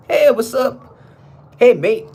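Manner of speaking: high-pitched put-on British greeting; mock British accent. Imitating folk who moved to the UK, mock British accent. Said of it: "with that accent".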